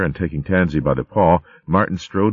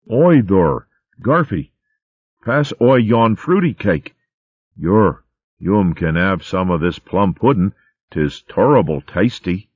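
put-on thick rustic molespeak accent, chuffed about pudding; a little mole in broad molespeak, offering food; molespeak country accent; 3 semitones higher than usual. Mole babe offering plum pudding at the feast, broad rustic molespeak accent.

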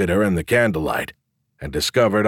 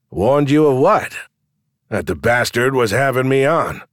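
barkeep voice; 5 semitones higher, 3 dB louder than usual. Acted.

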